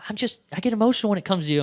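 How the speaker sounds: mock-emotional, high and hurried; imitated emotional woman. Explaining tears to her puzzled partner, imitated emotional. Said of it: "she cried, like, halfway through it".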